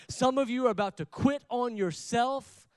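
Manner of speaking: ordinary talk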